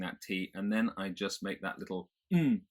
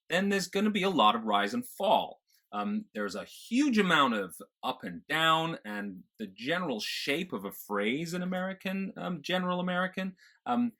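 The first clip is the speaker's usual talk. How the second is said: musical General American, big rises and falls; over-the-top American lilt, pitched high, landing hard; American feel; 5 semitones higher, 3 dB louder than usual. Shown as a demonstration. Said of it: "get that real American feel".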